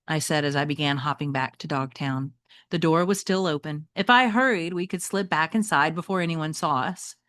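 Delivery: reading a novel aloud to the class; teacher reading a chapter book to students; story narration. Acted. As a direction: tell it like a read-aloud storyteller.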